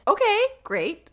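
high sarcastic fall on okay; sarcastic okay great. Real, not acted. Reacting to the killer's post with high sarcasm. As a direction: drip sarcasm at a terrible idea.

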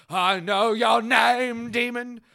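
loud, slow, high hammed-up exorcist; booming mock exorcism declaration; dramatic exorcist. Hammed exorcism climax quote.

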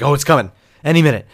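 loud, fast burst of dread; acted-out panicky anticipation; mock panic. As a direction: blurt it out as if doom is imminent. Acting out two weeks of dread.

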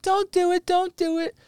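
very high, thin mock-wife voice; pleading wife. Storyteller voicing wife holding husband back, high-pitched pleading. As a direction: imitate a wife begging repeatedly. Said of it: "his wife's still like".

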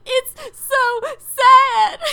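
loud, high, slow wailing through laughter; hammed-up sobbing it's so sad; mock wail. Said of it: "I said".

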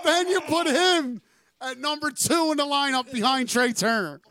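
excited shouting. Real, not acted. Hyped rant about signing.

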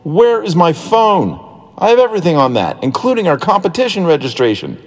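put-on high, anxious boy's voice; rising panic, pitched high; panicked. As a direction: fret over the missing phone. Panicked search for lost phone.

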